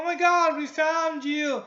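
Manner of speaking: high, slowed-down cry of joy; excited relief. Retold or imitated. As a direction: gush it out like finding him alive. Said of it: "the fiance's like".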